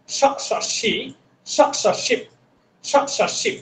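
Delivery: স, স, সি with a soft hissed s; thin s sound. Shown as a demonstration. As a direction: hiss a thin s at the tongue tip. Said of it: "এই স সাউন্ডটা একেবারে স".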